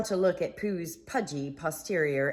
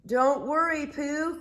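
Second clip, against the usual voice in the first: calling up; 10 semitones higher, 5 dB louder than usual. Acted. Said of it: "called from the ground".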